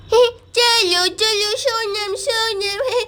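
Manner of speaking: put-on slightly babyish, drawn-out words; a hint of a tiny boy calling his friend; lightly voiced toddler. (छोटे बच्चे की तोतली आवाज़)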